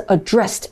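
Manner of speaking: "addressed" stressed on the second syllable, uh-DRESSED; weak first vowel in "addressed", stress on DRESSED. Shown as a demonstration. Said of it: "它是addressed一個發音哦". (addressed重音放在第二音節)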